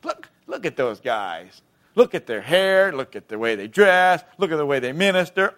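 imitated sneering critic; mock scoffing. Believers judging ministers by their looks, mock scoffing. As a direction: scoff at them, dragging out the words. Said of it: "we scoff at 'em".

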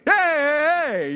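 high, loud "hey, hey, hey" shoved away; mock yell. Junior high kid yelling at a friend to back off. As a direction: yell it at the friend who touched your hand.

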